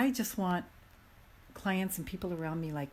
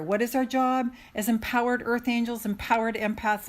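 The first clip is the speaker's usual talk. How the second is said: normal talking voice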